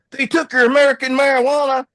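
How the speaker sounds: high, loud, fast disbelieving cry; incredulous. Retold or imitated. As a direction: shriek it in outraged disbelief. Guard incredulous at confiscation.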